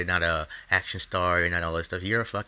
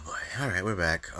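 normal talking voice

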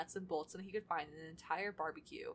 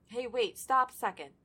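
louder sudden call to stop; 4 semitones higher, 9 dB louder than usual. Acted. Character spots something, calls out.

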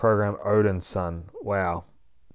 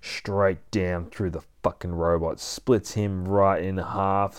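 usual speaking voice

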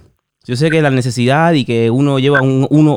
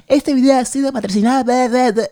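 higher, announcer-style ad read, tongue in cheek; mock sponsor read; 10 semitones higher than usual. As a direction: parody the YouTuber reading a sponsor line, pitched up. Imitating a YouTube sponsor read.

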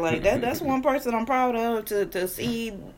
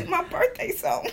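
high voice; 11 semitones higher than usual. Real, not acted. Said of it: "trying to get my voice up".

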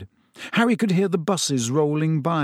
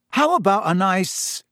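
mock-innocent suggestion, lightly raised pitch; 5 semitones higher, slower than usual. A narrator's reading. Boy suggests birthday present to girl, innocently. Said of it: "said Ron innocently".

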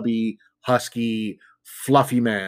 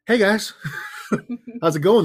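fake casual; 10 semitones higher than usual. Acting casual after scary run.

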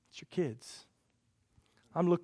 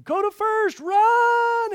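much higher, loud shout from the bleachers; cheering parent yell; 9 dB louder, much slower than usual. Retold or imitated. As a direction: yell like a parent at Little League. Parents screaming in the stands.

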